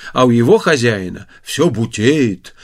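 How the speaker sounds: plain reading voice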